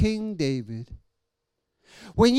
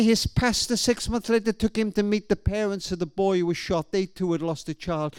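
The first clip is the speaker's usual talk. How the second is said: ordinary delivery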